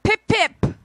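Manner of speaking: high, hammed-up posh British; chirpy British pip pip; Cockney accent. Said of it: "your best Cockney".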